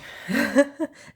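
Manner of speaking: a burst of high laughter at herself; embarrassed giggle at her own slip; high, loud laugh. Real, not acted.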